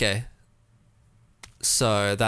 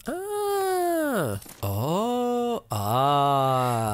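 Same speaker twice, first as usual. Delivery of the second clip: drawn-out ahs and ohs, starting very high; long oohs of discovery; dawning realization; 5 semitones higher, much slower than usual. Real, not acted. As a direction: let the penny drop slowly. Puzzle solution dawns on player.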